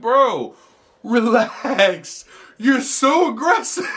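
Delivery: amused scolding of the stalker; exasperated. Retold or imitated. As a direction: tease him to calm down through a laugh. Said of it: "And I'm like".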